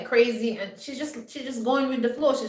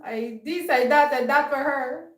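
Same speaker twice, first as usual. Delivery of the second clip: mock crying friend; 5 semitones higher than usual. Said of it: "He was crying and he was saying".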